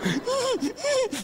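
high-pitched wheezy squealing laugh; mock squeal. Kicker taunts the trembling goalkeeper, high squealing mock laugh. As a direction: squeal with mocking laughter in falsetto.

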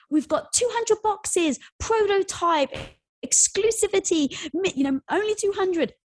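imitating a breathless launch announcement; hyped sales blast. Founders blasting customers on Facebook, high, slow hype.

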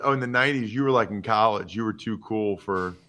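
usual speaking voice

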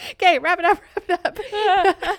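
high, choked-up laugh-cry; fighting back tears with a laugh; laughing through tears. Real, not acted. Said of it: "no, it's happening".

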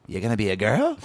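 incredulous parent, voice swooping up at the end; mock a baffled parent's question; put-on parent asking in disbelief; disapproving parent. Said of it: "my representation or my parents go".

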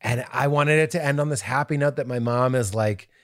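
normal talking voice

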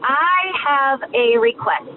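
ordinary talk

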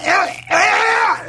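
high strangled cry; choking heart attack gasp. Acted. Man collapsing at dinner, strangled gasping cry.

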